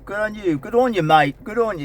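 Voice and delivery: high, put-on Australian accent cheering someone on; exaggerated Australian-accent congratulations with swooping pitch. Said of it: "speak with an Australian accent".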